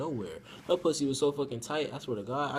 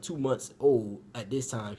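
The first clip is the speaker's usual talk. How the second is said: usual speaking voice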